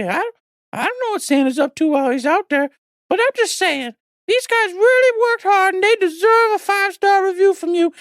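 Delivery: high, chipper little elf voice talking fast and eager; cheery elf. Acted. Elf guest begs podcast listeners for five-star reviews, high and chipper. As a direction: play a jolly Christmas elf pleading with the listeners.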